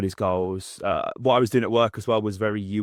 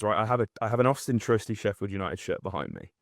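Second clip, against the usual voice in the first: usual speaking voice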